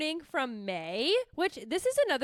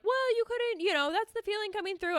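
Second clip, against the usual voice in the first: higher, lightly voiced therapist; 8 semitones higher than usual. Therapist answering a friend who quit journaling, lightly voiced therapist. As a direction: gently explain like a therapist. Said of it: "I did therapist a little bit".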